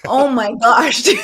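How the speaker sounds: loud laughter breaking through the words; laughing loudly. Real, not acted. Guest laughing at the host who mocked her accent, loud laughter. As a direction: laugh hard as you say it.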